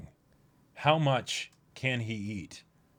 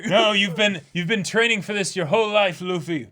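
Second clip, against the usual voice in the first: high, loud pep talk; rally him like a coach; mock encouraging cheer; 9 semitones higher, 9 dB louder than usual. Encouraging dub line.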